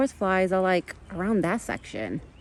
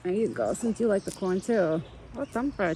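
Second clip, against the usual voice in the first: usual speaking voice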